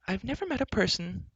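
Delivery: high, lightly voiced young heroine; a hint of secrecy in a high voice; hushed heroine. Heroine murmuring to her companions, mildly hushed. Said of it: "whispered Elenwy".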